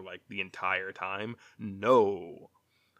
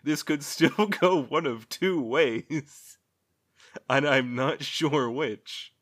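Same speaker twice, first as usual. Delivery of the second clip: slow, louder, laughing aside; laughing slowly; 4 semitones higher, 5 dB louder, slower than usual. Retold or imitated. Reviewer unsure how the finale goes, laughing slowly. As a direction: drag the words out through laughter. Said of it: "I'm like".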